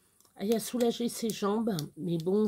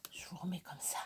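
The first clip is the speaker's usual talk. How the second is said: whisper; 4 semitones lower, 14 dB quieter, faster than usual. Real, not acted. Hushed aside.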